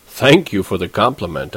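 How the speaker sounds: lightly put-on smooth charm; understated mock-suave politeness. A narrator's reading. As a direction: accept it like a man of the world. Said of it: "I said, suave as hell".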